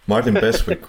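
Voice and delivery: breaking into a real giggle; laughing. Real, not acted. Host trying to resume the cast list, laughing. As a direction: try to get the name out through laughter.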